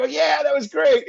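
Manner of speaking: high, loud, drawn-out mock cheer; acted-out enthusiastic yell; gleeful agreement. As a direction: agree in a high, stretched, exaggerated shout. Acting out joking reply to James.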